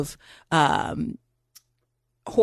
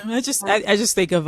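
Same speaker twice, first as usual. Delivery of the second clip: ordinary talk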